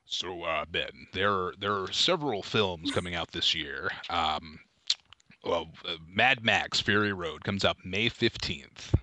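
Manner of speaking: low, slow actor attempting an American accent; Liam Neeson. Telling co-host about upcoming films, low, measured American-accent impression. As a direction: drop low and drag out an imitation American accent. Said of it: "can I do Liam Neeson doing an American accent".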